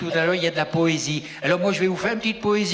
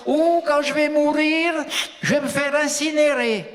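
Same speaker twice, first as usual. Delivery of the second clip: high-pitched mock declaration; quoted politician; 8 semitones higher than usual. Comedian mimics a politician interviewed about dying, high-pitched mock declaration. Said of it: "Elle disait".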